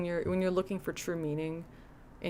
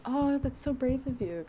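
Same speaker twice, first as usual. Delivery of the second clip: higher, gushing fake praise; mock admiring crowd; 7 semitones higher than usual. The group flattering the guy who never cries, mock gushing. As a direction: coo over him like he's a hero. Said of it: "And everyone's like".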